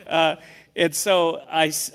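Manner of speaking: ordinary talk, nothing marked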